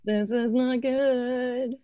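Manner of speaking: high-pitched drawn-out playful lament; mock dismay. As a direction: wail it slowly in pretend distress. Mock dismay at audio dropping out.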